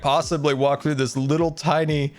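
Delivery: hammed-up sarcastic lament of a foiled villain; Vader. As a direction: whine with exaggerated fake helplessness. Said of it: "Vader, like".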